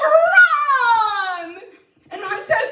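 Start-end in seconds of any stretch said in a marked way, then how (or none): 0.1-1.5 high, loud cry of his name; excited call. Acted. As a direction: rush in calling Ron. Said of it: "she said".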